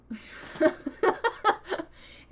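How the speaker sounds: loud, high burst of laughter; real laughter. Real, not acted. Player amused by the couple's dialogue, loud laugh. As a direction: crack up laughing.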